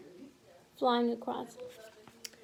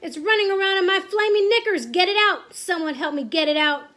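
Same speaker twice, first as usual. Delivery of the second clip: loud panic, high at first, then dropping in pitch; screaming; 5 semitones higher, 7 dB louder than usual. A narrator's reading. Cook pleading for help with the mouse, screaming. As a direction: beg for rescue at full voice. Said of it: "the man was screaming".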